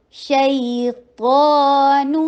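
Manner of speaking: شیطان very slow with long ا; two-count length. Shown as a demonstration. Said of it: "ٹو حرکہ long". (شیطان میں الف دو حرکت لمبا)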